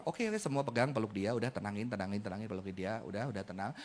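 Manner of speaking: low, soft calming voice; soothing calm. Shown as a demonstration. Parent calming a panicking child, low and soft. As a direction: settle a panicking child gently. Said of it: "Breathing, udah nafas tenang".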